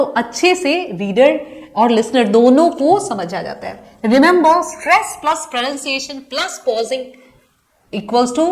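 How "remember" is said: ordinary talk, nothing marked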